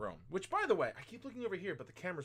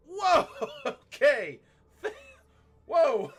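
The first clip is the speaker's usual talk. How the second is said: loud, high whoa breaking into laughter; startled whoa; 11 semitones higher, 11 dB louder, much slower than usual. Retold or imitated. Teacher reacting to girl's overshare, startled and laughing. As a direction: blurt it out, stretched and laughing. Reacting to retold poop confession.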